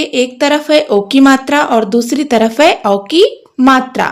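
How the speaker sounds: ordinary talk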